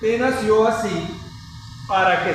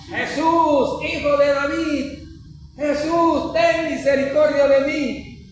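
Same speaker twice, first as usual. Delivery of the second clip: high, slow pleading call, much higher in pitch; retold loud cry; 8 semitones higher, slower than usual. Blind man calling after Jesus through the crowd, high pleading cry. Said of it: "con más fuerza el hombre gritaba".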